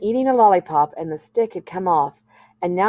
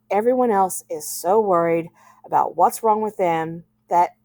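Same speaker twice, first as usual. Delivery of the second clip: plain reading voice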